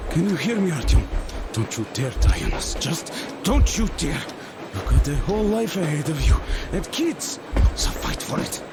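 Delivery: impassioned urging, rising in pitch; will a wounded friend to fight; urgent plea. Acted.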